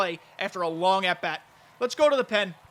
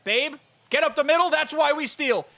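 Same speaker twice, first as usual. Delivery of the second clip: excited cheering; 6 semitones higher, 6 dB louder than usual. Real, not acted. Cheering his runner on.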